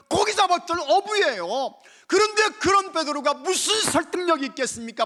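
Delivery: loud, high preaching cry; shouting the gospel from the pulpit; fiery preaching. Acted. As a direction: proclaim it with full force. Preacher at full force.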